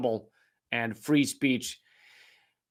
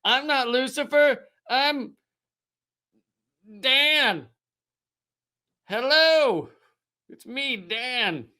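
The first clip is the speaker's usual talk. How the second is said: high, innocent put-on voice, slowing on the last words; the devil pretending to be a harmless guy; devil in disguise; 8 semitones higher, slower than usual. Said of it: "They went".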